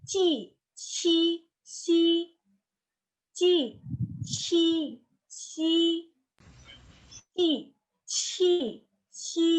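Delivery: high, level, drawn-out "ci" syllables; tongue-body sounds. Shown as a demonstration. Teacher drilling badan lidah, high level syllables. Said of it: "badan lidah itu".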